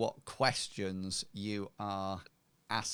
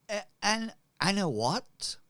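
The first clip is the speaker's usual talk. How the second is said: high-pitched, louder baffled query; puzzled; 9 semitones higher, 6 dB louder than usual. Acted. Questioned merchant caught by an unknown name, puzzled. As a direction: stumble on the name, voice jumping up.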